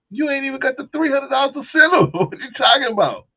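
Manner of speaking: man laughing off his sister-in-law, high and loud; mock retort. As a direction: crank it up high and laugh at her. Mocking her lack of money.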